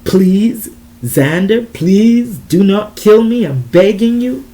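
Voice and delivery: loud pleading, rising in pitch; desperate begging. A narrator's reading. Desiree begging Xander for her life, desperate plea. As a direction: plead for mercy, stretching each please.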